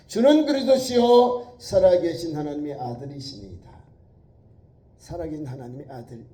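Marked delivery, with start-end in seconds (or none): 0.1-3.7 louder proclaimed confession; confession quoted. Acted. Preacher quoting Peter's confession to the church, loud. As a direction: say it as a creed. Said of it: "신앙 고백에 나와요".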